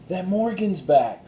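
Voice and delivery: high, slow thrilled voice; thrilled girl. Acted. Annie telling Jack Morgan is back, thrilled. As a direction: savor the good news.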